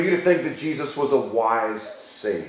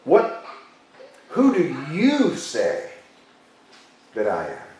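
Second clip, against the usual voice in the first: "you" drawn out long and raised in pitch; stress on you; 3 dB quieter, slower than usual. Shown as a demonstration. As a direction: ask very slowly, landing hard on "you". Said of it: "He puts the emphasis on you".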